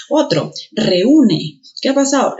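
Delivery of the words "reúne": reúne drawn out as re-Ú-ne; reúne with e and ú clearly apart; stress on the ú. Shown as a demonstration. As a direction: slowly put the force on the ú of reúne. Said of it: "La E con la U se han separado".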